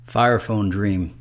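plain reading voice

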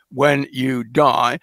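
very slow, low when you die; slow, deliberate. Shown as a demonstration. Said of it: "she carefully doesn't say".

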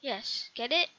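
normal talking voice

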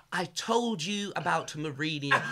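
a slow, put-on posh phone voice, half laughing; mock his mum on the phone; teasing mum for her phone voice, imitated; mum's answering machine voice. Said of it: "answering machine voice".

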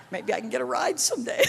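words spilling into laughter; laughing. Real, not acted. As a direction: laugh through the thought. Laughing at own joke.

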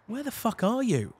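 retold radio outburst, mildly heated; exasperated demand. Dave demanding Rob's position over the radio, exasperated.

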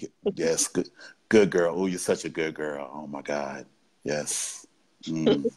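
put-on low, near-whispered good girl; hushed praise. Dominant praises partner in near-whispered low tones. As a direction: breathe the praise softly.

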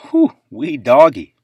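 loud, slow, amazed whew; whoa exclamation. Real, not acted. Collector gasping at a card price spike, loud slow whew. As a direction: let the price knock the wind out of you.

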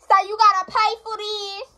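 loud, high-pitched call. Real, not acted. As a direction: holler it to someone in another room. Calling out to someone elsewhere.

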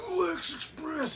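slow, high ring announcer call. Pretend. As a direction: call it like a hype man. Repeating the catchphrase.

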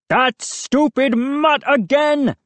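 high, drawn-out irate shout; angry shout. A narrator's reading. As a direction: rage slowly at a pesky dog. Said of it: "he shouted".

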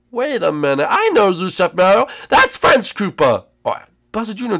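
normal talking voice

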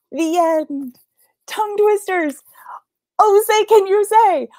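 high, loud storybook sign-off; book title flourish. A narrator's reading. Storyteller closing the tongue twister book, excited flourish. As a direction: announce the title with a big flourish.

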